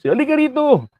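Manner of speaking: high, carrying call to come over; calling a fellow vlogger over to join. Retold or imitated. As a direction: wave someone over across a crowd.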